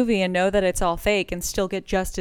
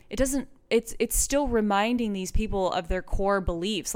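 ordinary delivery

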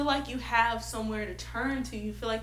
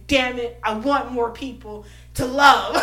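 exclaiming; 12 dB louder than usual. Acted. Quoted mirror moment.

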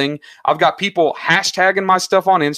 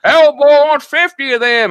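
put-on Southern accent; 10 semitones higher, 7 dB louder than usual. Southern accent, hyped-up seller shouting about the order. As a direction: ham up a toothless Southern seller. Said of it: "You like my Southern accent?".

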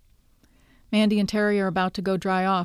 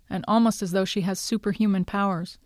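ordinary delivery

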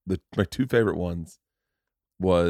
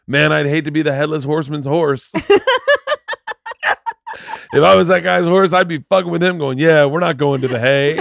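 excited retelling; 6 semitones higher, 6 dB louder than usual. Retold or imitated. Quoting jokes excitedly.

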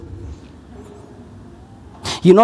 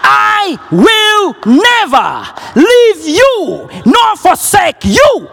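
high, slow, ringing proclamation; stretched, soaring declaration; proclaimed; 11 semitones higher, much slower than usual. Real, not acted. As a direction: shout the promise out slowly. Preacher proclaims God's promise.

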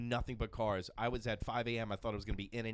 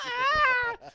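very high, loud tantrum shriek; kid's shriek; 11 dB louder than usual. Retold or imitated. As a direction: shriek like a wailing child. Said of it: "a kid behind me just going".

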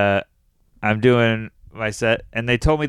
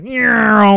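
high, louder mock meow; kid's meow; 9 semitones higher, 7 dB louder than usual. Comic imitating a heckling kid's meow, mock meow. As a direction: imitate a child meowing from the crowd. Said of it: "just went".

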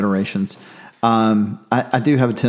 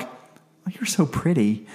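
mock sweet; 3 semitones higher, 4 dB quieter, slower than usual. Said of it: "I do look at people sometimes like".

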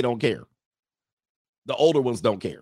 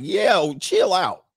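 higher-pitched protest; mock alarm; 6 semitones higher, 4 dB louder, much slower than usual. Imagined listener to harsh Swedish talk, mock alarmed protest. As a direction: cut in high, telling them to back off. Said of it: "you be like".

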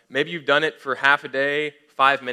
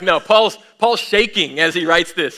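loud, raised, emphatic preaching; worked-up insistence; 5 semitones higher, 7 dB louder than usual. Real, not acted. Preacher correcting the calm reading of Paul, loud and worked up. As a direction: push it out loudly against the calm version.

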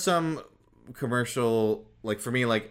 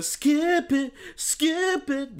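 high chirpy commercial jingle; sing-song jingle; much slower than usual. Retold or imitated. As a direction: chant it like a toy ad. Quoting Skip It commercial.

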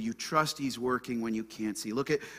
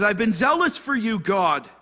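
high, loud protest; Elijah's complaint; 9 semitones higher, 10 dB louder than usual. Acted. Elijah complains of rejected covenant, loud lament. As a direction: plead your case loudly to God. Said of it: "look what Elijah says".

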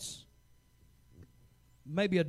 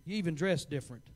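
rising shout of a fiery preacher; preaching at full force; 7 semitones higher, 7 dB louder than usual. Acted. Pastor to his church, preaching at full force. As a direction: preach it hard and build.

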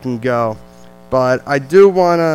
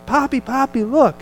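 put-on child's voice; 9 semitones higher than usual. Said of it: "she's like".

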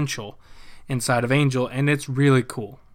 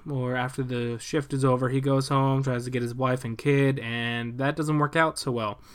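ordinary talk, nothing marked

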